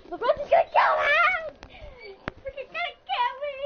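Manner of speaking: hammed-up terrified yelling; mock panic scream. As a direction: shout it like you're about to be killed. Fake panic, calling for help.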